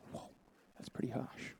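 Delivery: breathy whisper, barely audible; whispered aside. Real, not acted. Preacher whispering to the congregation about the harsh prophecy, whispered. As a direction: say "that's pretty harsh" under your breath, unvoiced.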